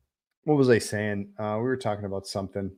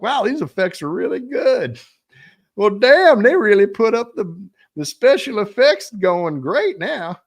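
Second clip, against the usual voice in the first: high, loud hammed-up Southern drawl; 12 semitones higher, 11 dB louder than usual. Streamer imitating a movie fan, in a loud hammed-up Southern accent. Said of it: "that's my Southern draw accent right there".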